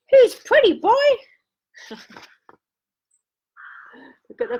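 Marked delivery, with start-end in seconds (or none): 0.1-1.2 much higher, louder parrot squawk; mock parrot chirping the phrase; parrot talk. A narrator's reading. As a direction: squeal it like a talking parrot. Parrot imitating people.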